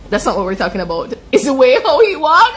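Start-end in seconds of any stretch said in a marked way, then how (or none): 1.3-2.6 higher and louder, laughing through the words; real laughter breaking into the line. Real, not acted. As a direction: giggle out the punchline. Laughing at her own joke.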